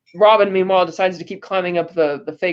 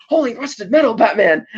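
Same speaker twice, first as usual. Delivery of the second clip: mock exclamation; 4 semitones higher than usual. Quotes Robin's exclamation from film.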